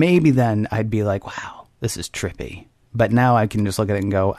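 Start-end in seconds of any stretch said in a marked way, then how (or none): 1.3-2.7 hushed, slow "wow"; awed whisper. Pretend. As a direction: breathe it out in wonder. Imagined awed reaction.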